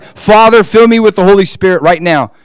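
loud, high imitated plea; eager urgent prayer. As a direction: imitate a hungry, insistent cry to God. Imitating her eager, hungry prayer.

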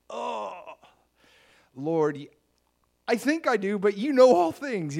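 anguished plea. Acted. Peter's anguished third answer.

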